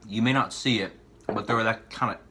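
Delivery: normal talking voice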